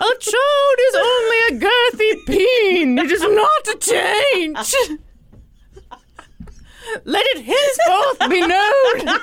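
slow, loud, high proclamation; town crier. Pretend. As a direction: declare it like a royal decree. Mock royal proclamation.

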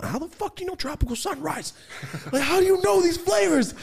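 very high, incredulous outburst; acted bafflement. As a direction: squawk it in comic disbelief. Acting out his baffled reaction.

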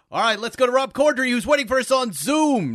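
big, high, fast announcer voice; radio guy voice. Pretend. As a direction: boom it out like a radio host. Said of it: "That was my radio guy voice".

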